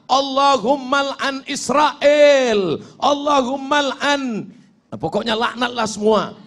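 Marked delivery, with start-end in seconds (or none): 0.1-4.5 high, drawn-out shouted supplication; impassioned prayer cry. Acted. Imam praying qunut against Israel, impassioned high cry. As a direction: cry out the curse slowly and forcefully. Acts fiery curse prayer.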